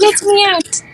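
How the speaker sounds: high, badly faked Australian; attempted Australian accent. Host trying the Australian doll, badly faked high Australian accent. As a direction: have a hopeless go at Australian. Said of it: "I don't have an Australian accent".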